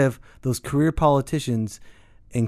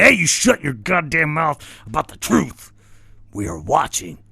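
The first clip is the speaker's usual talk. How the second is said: menacing watcher voice; 2 semitones higher than usual. Acted. Secret watchers warn the ranter to shut up, menacing. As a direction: play a shadowy power issuing a warning.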